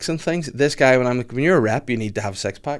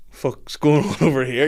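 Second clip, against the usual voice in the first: slow, puffed-up, higher challenge; mock tough lad; 4 semitones higher than usual. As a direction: play the chest-out protective lad. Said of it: "chest pumped out".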